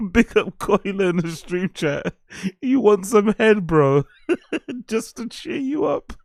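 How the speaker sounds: high, helpless giggling through the words; real laughter squeezing the voice upward; laughing. Real, not acted. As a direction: crack up at your own cheeky offer. Cracking up at own cheeky joke.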